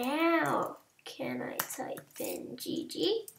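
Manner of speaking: hushed unvoiced whisper throughout; soft whispered muttering to self. Real, not acted. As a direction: whisper it like someone's asleep nearby. Whispering through chat fix.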